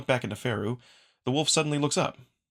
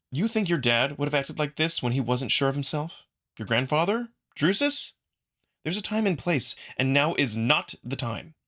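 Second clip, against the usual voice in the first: higher and louder, building indignation; heated scolding; 4 semitones higher, 3 dB louder than usual. Acted. Marco scolding the wolf before his trial, heated and rising. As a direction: shake him out of his self-pity.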